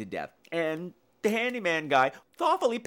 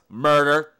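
louder, pointed interruption; sassy cut-in; 8 dB louder than usual. Retold or imitated. Ellie cutting off the matron, sassy and louder. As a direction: snap the word in to cut her off. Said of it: "And she interrupts".

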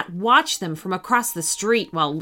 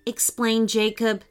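ordinary delivery

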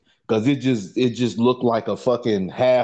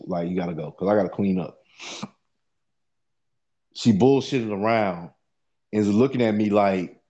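usual speaking voice

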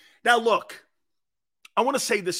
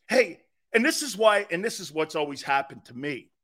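ordinary delivery

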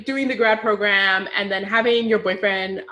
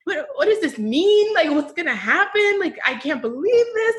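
pitched up high, shocked and bewildered; shocked disbelief; 10 semitones higher than usual. Retold or imitated. Friends abroad reacting to election news, high shocked disbelief. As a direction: ask like the news just hit you.

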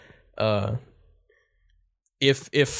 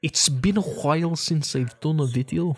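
mock Scottish lilt, pitched up; Scottish accent imitation; 5 semitones higher than usual. Friend imitating a Scottish YouTuber's intro, mock Scottish lilt. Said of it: "He's Scottish".

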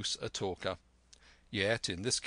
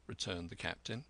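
ordinary delivery, nothing marked

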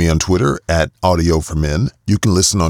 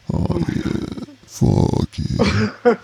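breathy moan; 13 semitones higher, 4 dB quieter, much slower than usual. Real, not acted. Climax fading, breathy moans.

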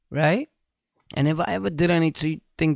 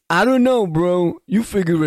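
sassy shrug; 3 semitones higher, 4 dB louder than usual. Real, not acted. Mock-defensive shrug to audience.

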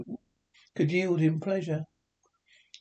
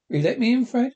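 higher, louder hopeful request; eager plea; 7 semitones higher, 7 dB louder, faster than usual. A narrator's reading. Scrooge at the door asks Fred, higher and louder. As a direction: ask to come in, eagerly.

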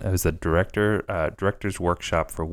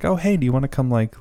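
kind director's invite; 7 semitones higher than usual. Retold or imitated. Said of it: "she just kind of was like".

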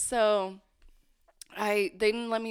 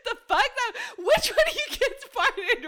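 much higher, louder mock indignation; squeaky outraged denial; stepmom's denial; 13 semitones higher, 4 dB louder than usual. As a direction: stepmom denying the fart, high indignant voice.

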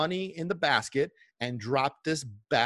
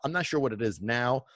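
usual speaking voice

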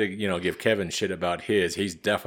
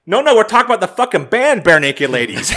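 much higher and louder, laughing through it; annoyed correction; 11 semitones higher, 11 dB louder than usual. Retold or imitated. Mom snapping at the clerk about the band, loud and laughing. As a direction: blurt it as the exasperated mom.

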